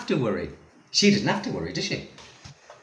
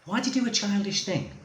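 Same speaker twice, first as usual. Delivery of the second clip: exasperated; 9 semitones higher, faster than usual. Retold or imitated. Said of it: "I'm thinking".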